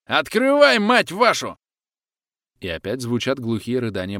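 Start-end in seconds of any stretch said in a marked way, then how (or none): 0.1-1.6 high, loud furious yell; furious shout. A narrator's reading. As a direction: scream it, pounding the door. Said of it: "заорали за дверью". (яростный крик, высоко и громко)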